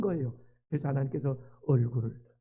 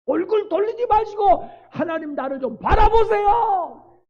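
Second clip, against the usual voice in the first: pleading prayer; 13 semitones higher, 6 dB louder than usual. Acted. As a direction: beg God not to turn away. David's pleading prayer voiced.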